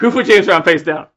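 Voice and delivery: loud outraged demand; loud drunk yell. Retold or imitated. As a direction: yell it at the room. Retold rant again.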